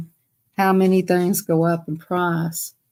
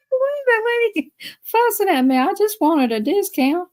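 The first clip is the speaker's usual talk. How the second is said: mock lady; 11 semitones higher than usual. Quoting the fussing lady.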